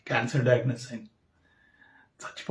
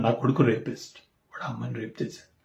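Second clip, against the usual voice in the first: broken confession; 3 semitones lower, 7 dB quieter than usual. Real, not acted. Father confessing son's crime.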